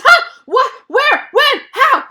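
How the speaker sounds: high, loud burst of startled questions. Acted. Gerald wakes from his nap asking questions, loud and startled. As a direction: fire out each word as a separate loud, high yelp. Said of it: "he asks them so loudly".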